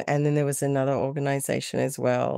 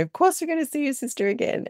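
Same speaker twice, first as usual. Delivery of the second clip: high, quick upbeat reassurance; retold cheery promise, pitched right up; all positive; 13 semitones higher, faster than usual. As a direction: brightly reassure a child, fast and high. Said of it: "all positive".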